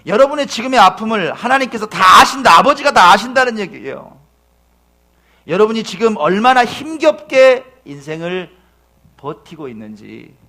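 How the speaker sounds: loud, rising pulpit appeal; full-force preaching. Acted. 지친 성도들에게 위로를 외치며, 힘찬 설교. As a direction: pour it out over the congregation.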